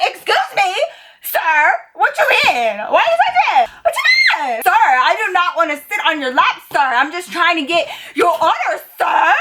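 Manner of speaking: high, squealing mock outrage; flipping out. Waitress pulled onto a customer's lap, flipping out in indignation. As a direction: shriek indignantly, hammed up, punching each sir. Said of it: "I was flipping my shit".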